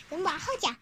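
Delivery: usual speaking voice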